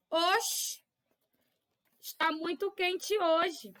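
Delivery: slow, drawn-out Bahian accent on "oxe"; Bahian accent demo. Shown as a demonstration. Teacher imitating a Bahian on a hot day, slow Bahian accent. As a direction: say it like a relaxed Bahian complaining of heat. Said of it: "Se fosse na Bahia".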